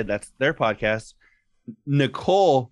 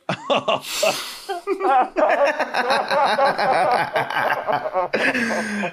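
a long real laugh that climbs high and falls away; hearty high laugh; 11 semitones higher than usual. Real, not acted. Laughing hard at his own wedding mix-up. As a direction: crack up laughing at your own story.

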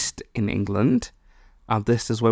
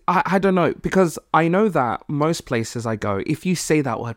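ordinary talk

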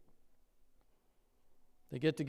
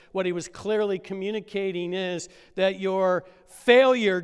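rising, louder preacher's climax; preaching at full force; 6 semitones higher, 8 dB louder than usual. Acted. Pastor voicing Jesus' forgiveness to the church, preaching loud. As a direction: drive it home to the congregation.

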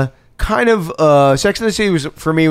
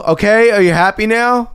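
higher-pitched, mildly indignant protest; put-on exasperation, pitched up; exasperated suspect; 9 semitones higher, 3 dB louder than usual. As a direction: act out a cornered suspect, fed up. Unmasked suspect protests under questioning.

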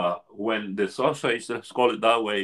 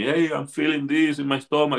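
higher-pitched mild alarm, imitated; retold call for help, pitched up; participant calling for help; 7 semitones higher than usual. Participant calls the facilitator about his stomach, mildly alarmed. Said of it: "he calls me".

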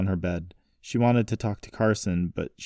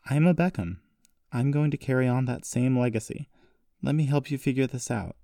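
put-on higher-pitched, earnest young heroine; earnest, higher character voice; determined granddaughter; 6 semitones higher than usual. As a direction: press your case to grandfather in a raised pitch.

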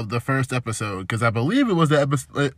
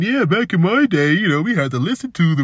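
put-on old man voice; 4 semitones higher than usual. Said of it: "making fun of an old man".

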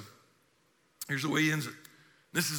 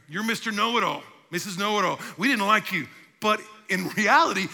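ordinary talk

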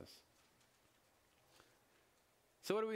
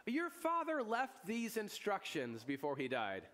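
mock innocent; 9 semitones higher, slower than usual. Brothers' fake message, teller laughing.

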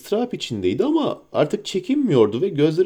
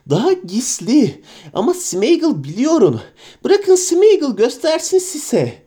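higher wheedling creature voice; Gollum voice; 9 semitones higher, 3 dB louder than usual. Acted. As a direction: coax him with a secret. Gollum persuades.

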